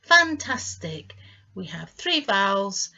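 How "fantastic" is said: Fantastic said high and loud, slowly; enthusiastic praise. Shown as a demonstration. Teacher to learners, praising them with the word. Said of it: "which is what you are".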